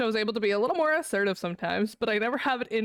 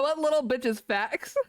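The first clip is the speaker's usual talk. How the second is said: ordinary talk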